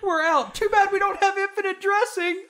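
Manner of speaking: squeaky high character voice; high silly voice. Acted. Acted rescuer reply.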